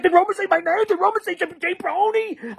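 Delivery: high, eager questions in a retold voice; excited. Friend texting to ask if Roma said his name, excited. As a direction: imitate a friend bursting with excitement. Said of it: "he was all excited".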